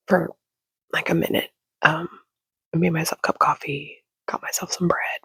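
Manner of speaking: hushed morning whisper so nobody wakes; soft whispered chatter. Real, not acted. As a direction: whisper it, the house is still asleep. Whispers while everyone sleeps.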